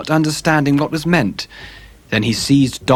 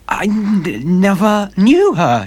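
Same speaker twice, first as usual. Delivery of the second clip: high, stammering fearful denial; 8 semitones higher, 4 dB louder, slower than usual. A narrator's reading. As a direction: stumble over the words in panic. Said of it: "he stammered".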